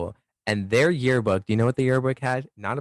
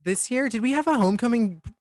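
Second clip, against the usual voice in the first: excited; 10 semitones higher than usual. Real, not acted. Excited high questions.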